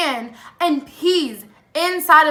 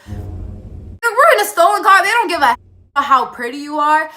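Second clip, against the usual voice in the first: high, loud, incredulous rebuke; exasperated outburst; 3 semitones higher, 5 dB louder than usual. Retold or imitated. Storyteller scoffs at the boy's idea in the stolen car, exasperated. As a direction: burst out in disbelief, playing it up.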